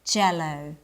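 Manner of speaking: jello with a firm J, tongue tip on the roof; J sound. Shown as a demonstration. Teacher showing lips on jello, firm J. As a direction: hit the J of jello hard. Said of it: "Can you see it".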